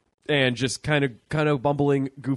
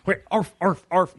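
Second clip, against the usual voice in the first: higher mock seal barks; barking seal imitation; 6 semitones higher, 3 dB louder than usual. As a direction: bark it out like a seal. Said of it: "Can you do a dolphin?".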